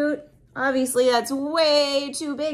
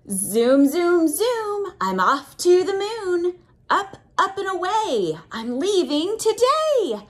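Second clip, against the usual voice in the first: sing-song rhyme read, rising high for the zooms; reading to children; 8 semitones higher, 3 dB louder than usual. Acted. Reading a rocket rhyme aloud to a child, sing-song. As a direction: chant it like a bedtime rocket rhyme. Reads rhyming picture book.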